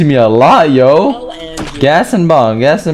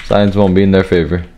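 normal talking voice

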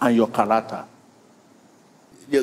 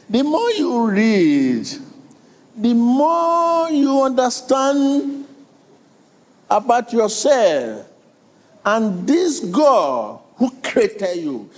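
higher, slow, sung-out preaching; preaching climax; 8 semitones higher, much slower than usual. Acted. Preacher at his climax to the congregation, higher and slow. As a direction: stretch every phrase at the peak.

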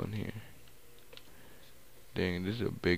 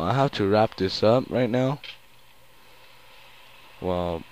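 ordinary delivery

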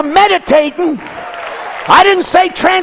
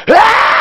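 mock wild shout; 5 semitones higher than usual. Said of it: "this idiot next to you".

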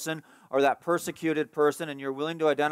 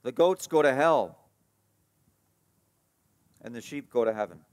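ordinary delivery

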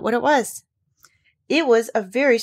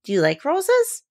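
high, slow eager question; light up with eager hope; eager girl; 10 semitones higher, slower than usual. A narrator's reading. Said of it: "Mary's face lighted up".

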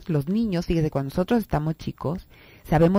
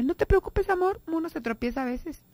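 much higher, softening tender comfort; reassuring mother; 12 semitones higher than usual. Pretend. Mother comforting her fallen child, tender and reassuring. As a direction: soothe a child who just tripped. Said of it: "en lugar de decirle".

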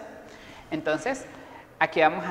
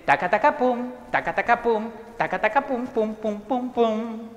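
rhythmic vocal drum pattern, pitched up; drum imitation; 4 semitones higher than usual. Shown as a demonstration. Teacher shows pupils the drum part, imitating drums. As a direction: chant it like drumsticks landing on a drum. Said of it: "El primero que vamos a hacer es la batería".

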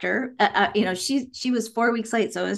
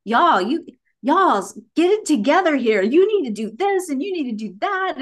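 put-on high, louder toddler bossing everyone; bossy little kid; 7 semitones higher, 4 dB louder than usual. Mother imitating her tiny daughter bossing people, high and loud. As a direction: boss the room like a tiny child. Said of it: "this teeny tiny little person, like".

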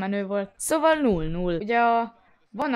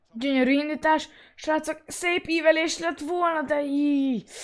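high, eager commentator call; excited sports commentary; 8 semitones higher, 4 dB louder than usual. Acted.

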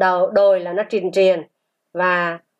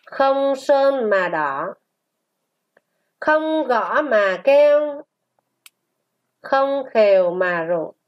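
slow, sustained syllables; model reading; 3 semitones higher than usual. Shown as a demonstration. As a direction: read it slowly for a class to repeat. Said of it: "Chúng ta đọc lại". (đọc mẫu chậm, ngân dài)

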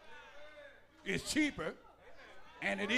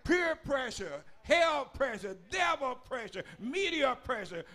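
preaching at full force; 5 semitones higher than usual. Real, not acted. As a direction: hammer each pressure out like a hellfire preacher.

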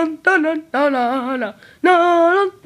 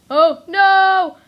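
alarmed shout; 5 semitones higher, 5 dB louder, much slower than usual. Real, not acted. Panicking at the filter.

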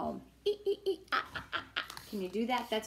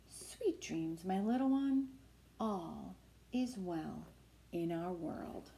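tender mommy; 2 semitones lower, 5 dB quieter than usual. Acted. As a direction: lull a child to sleep. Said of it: "Mommy says".

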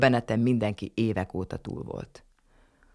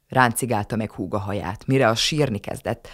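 ordinary delivery

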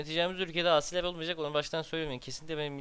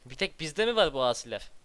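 louder, fed-up complaint at the game; exasperated outburst; 8 dB louder than usual. Real, not acted. Gamer fed up with rebels, exasperated loud outburst. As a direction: blurt it out, annoyed.

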